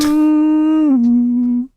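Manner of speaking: high loud Yahoo yodel. Pretend. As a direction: belt it like the Yahoo ad. Echoing the Yahoo yell.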